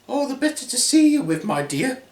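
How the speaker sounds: high fake granny voice; wolf posing as granny. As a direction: answer in a put-on high pitch.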